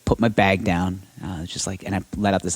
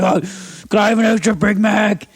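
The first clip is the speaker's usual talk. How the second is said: demanding customer voice; 11 semitones higher than usual. Retold or imitated. Podcaster mocks a pushy customer ordering a Big Mac, high and whiny. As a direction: play a pushy drive-thru customer. Rude fast-food customer quoted.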